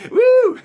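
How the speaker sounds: very high, loud whoop; excited whoop. Shown as a demonstration. Speaker fired up after his mirror chant, whooping. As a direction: let out a wild cheer. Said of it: "And I get all fired up".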